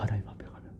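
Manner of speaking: breathy hushed whisper, barely voiced. Real, not acted. Preacher imagining facing God, whispering. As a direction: drop to an awed whisper before God.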